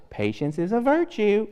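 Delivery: a light hint of a grandma, high and soft; mother or grandmother telling the kids, lightly mimicked. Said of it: "She said it just like that".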